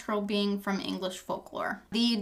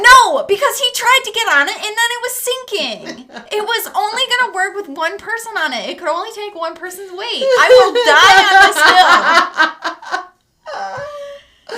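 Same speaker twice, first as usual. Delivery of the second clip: laughing insistence; 9 semitones higher, 12 dB louder than usual. Real, not acted. Laughing Titanic argument.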